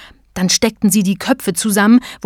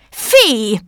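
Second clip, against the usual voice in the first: higher-pitched bellow, falling away; 4 semitones higher than usual. A narrator's reading. Button policeman spots Emma, bellowing. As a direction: bellow one drawn word as the button policeman. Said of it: "brüllte der Polizist".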